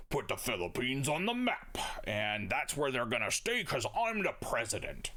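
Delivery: lightly put-on voice of a president; a hint of a self-important leader giving orders; William McKinley. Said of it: "he told the chief engineer".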